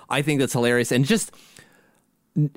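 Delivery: usual speaking voice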